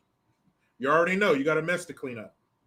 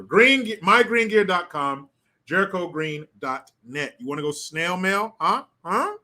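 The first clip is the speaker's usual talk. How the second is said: usual speaking voice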